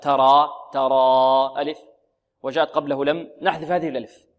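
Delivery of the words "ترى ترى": ترى with its last vowel stretched out slowly; drawn-out final alif. Shown as a demonstration. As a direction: hold the long alif at the end of ترى. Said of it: "إذا ختم الفعل المضارع بألف".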